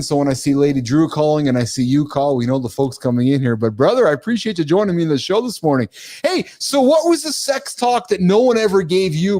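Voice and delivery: ordinary delivery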